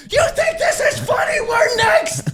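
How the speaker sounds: high, loud outraged yell; outraged shout. Retold or imitated. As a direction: yell at him for laughing. Yelling at laughing friend.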